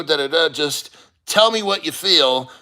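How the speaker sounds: normal talking voice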